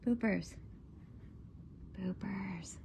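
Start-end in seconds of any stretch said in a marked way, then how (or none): 2.0-2.8 whisper. Real, not acted. Owner whispering the pet's name to get attention.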